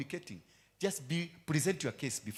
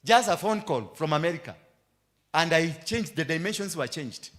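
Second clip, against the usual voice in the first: loud preaching; 4 semitones higher, 9 dB louder than usual. Acted. Preaching climax.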